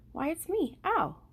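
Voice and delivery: lightly voiced wise old owl. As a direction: answer brightly from the branch. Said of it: "the voice answered".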